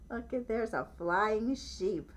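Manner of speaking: plain reading voice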